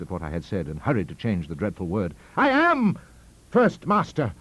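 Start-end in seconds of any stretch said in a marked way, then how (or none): 2.4-4.3 high, loud, outraged cry; screamed insistence. A narrator's reading. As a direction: scream your claim to the title. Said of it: "I screamed".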